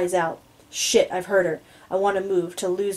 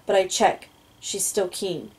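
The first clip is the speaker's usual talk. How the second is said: ordinary delivery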